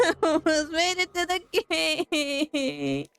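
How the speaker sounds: slow, laughing wail of defeat; drawn-out laughing lament; laugh-crying. Real, not acted. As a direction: laugh through mock tears. Dies again near the gate.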